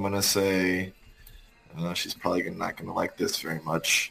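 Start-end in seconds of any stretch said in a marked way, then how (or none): 1.8-4.1 soft, low-key mutter to oneself; muttered under the breath. Pretend. Player muttering about someone who won't like the spell, under the breath. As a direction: mutter it quietly, barely meant to be heard. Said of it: "I'm gonna mutter under my breath".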